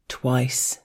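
ordinary delivery

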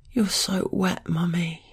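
whispering. Acted. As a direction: breathe it softly, girlish. Girl speaks to Mummy.

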